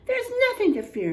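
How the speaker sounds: high, fast, too-cheery reassurance; falsely brave sister. Acted. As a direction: bluff that you're not scared.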